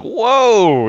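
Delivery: high, loud amazed whoa; startled gasping whoa; surprised whoa. Retold or imitated. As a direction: caught off guard with delight. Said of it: "I was like".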